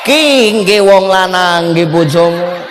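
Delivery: ordinary delivery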